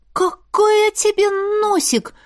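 high loud indignant cry. A narrator's reading. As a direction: fire it back indignant. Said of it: "возмутилась я".

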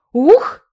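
high loud gasp from cold water; gasp surfacing. Acted. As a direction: gasp as you come up from water. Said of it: "вынырнула я из прохладной воды".

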